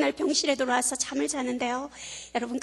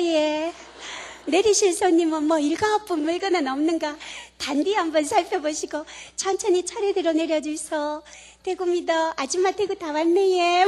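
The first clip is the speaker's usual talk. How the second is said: put-on casual Gyeongsang accent announcer, a bit higher; Gyeongsang dialect announcement; 4 semitones higher than usual. Speaker pretending the Daegu PA speaks in Gyeongsang accent. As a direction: Gyeongsang accent, chatting to riders like a local. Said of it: "그 방송을 경상도 사투리로 하는 거죠".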